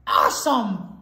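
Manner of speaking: sudden loud burst of delight; exultant shout. A narrator's reading. Skunk loves the bath after all, exultant shout. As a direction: punch it out loudly.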